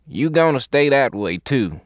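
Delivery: lightly voiced Southern medic; southern accent. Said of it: "with a southern accent".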